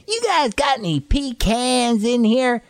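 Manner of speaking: high, loud drawn-out customer voice. Retold or imitated. Customer asking grandfather at the nursery for pecans, high exaggerated customer voice. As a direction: put on a high-pitched, slow stranger's voice. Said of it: "guy comes in, he says".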